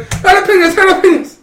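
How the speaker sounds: high yelled jalapenos; hammed-up frantic shout; mock panicked cry. Retelling family yelling at the picnic, high mock panic. Grandma hit again, shouting.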